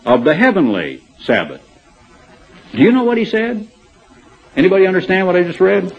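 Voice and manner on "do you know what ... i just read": high, fast, disbelieving question; incredulous question. Real, not acted. Preacher asking his congregation if they followed, high and incredulous. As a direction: toss it to the room as if it's absurd. Asks congregation after dense reading.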